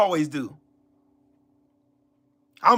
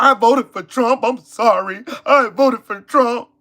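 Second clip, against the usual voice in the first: whiny mock apology, slower at the end; mocking Trump voter; 2 semitones higher, slower than usual. Streamer mocking a regretful Trump voter, whiny and sorry. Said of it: "talking about".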